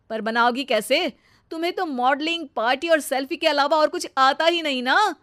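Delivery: loud, cutting telling-off; husband's angry rebuke. Acted. Husband scolds wife for shouting at his mother, angry. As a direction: shut her up and take her to task.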